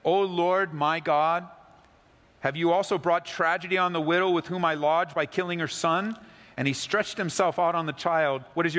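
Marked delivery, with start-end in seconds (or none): 0.0-6.2 raised, pleading call to the Lord; crying out to God. A narrator's reading. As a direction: lift the voice in anguished prayer. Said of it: "he cried out to the Lord".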